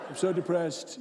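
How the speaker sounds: hammed-up gloom of a sulking leader; mock self-pity. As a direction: play at being miserable, for laughs. Mocking a depressed president.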